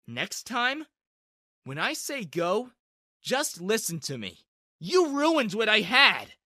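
loud, slow, angry outburst. A narrator's reading. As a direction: blame her, building to a yell. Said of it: "only made me angrier".

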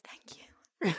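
breathy whispered thanks; whispered thank you. Retold or imitated. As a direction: murmur it back, barely voiced. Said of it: "she looks at Tess and goes".

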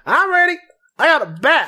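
high-pitched goofy lackey; high goofy Charlie voice. Acted. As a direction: play the eager dim henchman, way up high.

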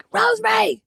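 high yelled name down a corridor; calling out. Retold or imitated. Boss shouting name down corridor.

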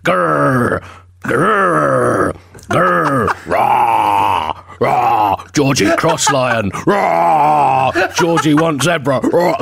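growling lion voice, roaring rah; playful monster growl. Acted. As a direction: growl like a big cat wanting zebra. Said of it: "you've been personifying animals".

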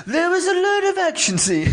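high, strange put-on voice; squeaky quoting voice; weird high voice. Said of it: "I don't know what that voice was".

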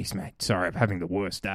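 soft sheepish apology; quiet apology. Retold or imitated. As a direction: mumble an embarrassed sorry. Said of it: "you sort of go".

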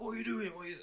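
high-pitched mock Staff accent; big Staff accent. Random fella in road rage, mock Staff accent. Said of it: "that was a big staff accent".

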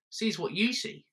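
ordinary delivery

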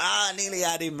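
high, loud retold exclamation; gleeful joke reveal. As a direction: imitate a prankster springing the joke. Retold joke reveal after silence.

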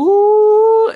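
long, loud falsetto ooh; high ecstatic "ooh". Retold or imitated. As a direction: squeal an ooh in mock swoon. Acts out hearing the vocal.

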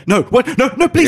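high, panicky mock begging; mock pleading. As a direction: squeal it out like a thief being carried off. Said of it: "and he's going".

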